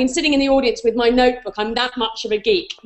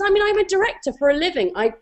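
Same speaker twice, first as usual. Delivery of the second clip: normal talking voice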